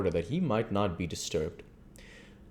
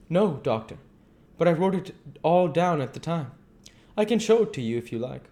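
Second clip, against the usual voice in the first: put-on higher, louder voice for a polite young woman; polite young wife; 10 semitones higher, 5 dB louder, faster than usual. Mina to the doctor, offering her hand, polite and gracious. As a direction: answer with gracious warmth.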